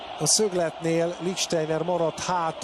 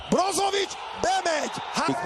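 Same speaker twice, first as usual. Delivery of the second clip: high shouted call; excited shout; 14 semitones higher than usual. Real, not acted. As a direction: yell it out. Brozovic breaks through.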